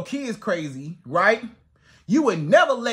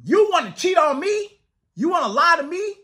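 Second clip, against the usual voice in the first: higher, sassy disbelief; pitched-up "oh really?" attitude; mock indignant challenge; 6 semitones higher than usual. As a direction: throw the question back at him, mock outraged. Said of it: "you gotta say".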